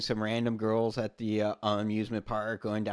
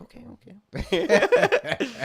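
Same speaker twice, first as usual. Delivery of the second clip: laughing meek okay; 11 semitones higher, 6 dB louder, much slower than usual. Retold or imitated. Meek reply after being yelled at.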